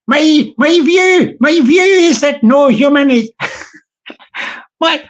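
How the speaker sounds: put-on South Dublin Irish accent. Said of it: "transitioning into Irish mode".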